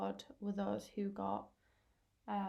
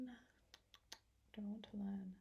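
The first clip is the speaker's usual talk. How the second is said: whispered aside to oneself, slow; thinking aloud; 11 dB quieter, slower than usual. Real, not acted. Tutor asking herself which topic to pick, whispered. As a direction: mutter it softly under your breath.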